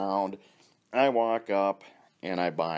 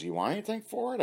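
high lady voice; 14 semitones higher, faster than usual. Retold or imitated. Pull tab lady quoted.

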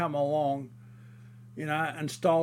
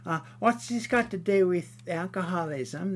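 mocking whiny woman; 4 semitones higher, 3 dB louder than usual. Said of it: "she goes".

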